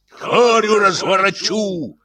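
high, slow furious threat; raging. A narrator's reading. Janitor threatens the locksmith in a frenzy. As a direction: roar the threat. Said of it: "неистовствовал дворник".

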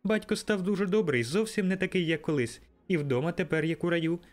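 a little sister bursting with joy; excited little girl. A narrator's reading. Little Fan greeting her brother, high and joyful. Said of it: "сяючи від щастя".

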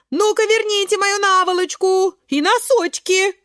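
high, loud demand; indignant shout. A narrator's reading. Черника demanding her washing back, high loud shout. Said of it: "кричит она".

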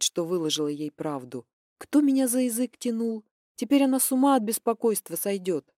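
usual speaking voice

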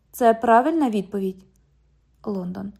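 ordinary delivery, nothing marked

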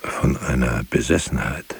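usual speaking voice

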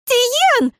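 shrill high hysterical cry; hysterical shout. A narrator's reading. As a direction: scream his name in hysterics. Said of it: "истерично выкрикнула Лейра".

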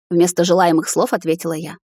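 ordinary delivery, nothing marked

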